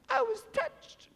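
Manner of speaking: high, slow tearful squeak; mock crying. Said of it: "Why are you crying?".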